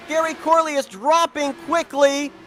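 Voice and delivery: urgent live play-by-play; motorsport commentator. Real, not acted. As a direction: call the incident as it unfolds. Live race call of a wreck.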